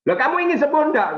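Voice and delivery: high, quick firm comeback; forceful retort. Retold or imitated. Pastor challenges a complaining congregant back, forceful. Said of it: "Saya ngomongnya ya kenceng balik".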